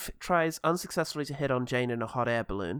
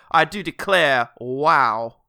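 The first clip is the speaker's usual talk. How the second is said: put-on loud southern accent slipping toward Christopher Walken; hammed-up Southern drawl, odd celebrity cadence; 8 dB louder, much slower than usual. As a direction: do a wonky, emphatic southern accent. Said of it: "that's getting close to Walken".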